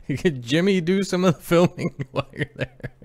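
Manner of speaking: high laughing wife imitation; laughing through words. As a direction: giggle through the question. Quoting wife while laughing.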